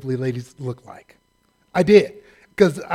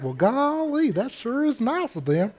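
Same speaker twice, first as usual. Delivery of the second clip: Gomer Pyle; 7 semitones higher than usual. Retold or imitated. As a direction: play the naive country bumpkin. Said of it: "Vallejo version of Gomer Pyle".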